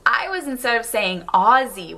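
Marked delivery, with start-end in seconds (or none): 1.3-2.0 "Aussie" with a voiced z; Australian z pronunciation. Shown as a demonstration. Vlogger showing viewers the proper form, "Aussie" with a z. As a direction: say "Aussie" as OZ-zee. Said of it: "the way you should say it".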